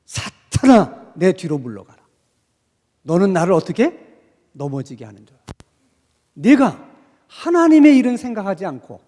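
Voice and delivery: Jesus rebuking Peter, stern and loud; stern rebuke. Acted. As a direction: drag out the name as a sharp reproof.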